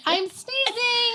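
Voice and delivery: high, slow teasing quote; mocking imitation. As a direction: mimic her sneeze excuse. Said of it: "And then you said".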